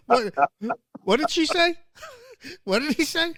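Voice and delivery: high, baffled asking; mock confusion. Baffled listener to the accent.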